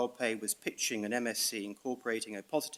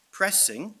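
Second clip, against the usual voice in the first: pressing lifted high and louder; stress on pressing; 9 semitones higher, 5 dB louder than usual. Shown as a demonstration. Barrister to the tribunal, hitting pressing high and louder. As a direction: lean hard on pressing before naming it. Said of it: "and I emphasize the word pressing".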